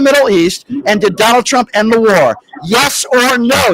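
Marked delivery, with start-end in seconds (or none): 2.6-3.7 drawn-out demand, slowly. Shown as a demonstration. Host to caller, slowly demanding yes or no. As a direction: press him slowly for an answer. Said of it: "go slow for you".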